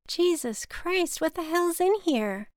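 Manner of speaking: strained surprise. Acted. Lifting a heavy stuffed bag.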